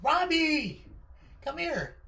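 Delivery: high call to her son; mom calling. Retold or imitated. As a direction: call the kid over across the store. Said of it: "she's like".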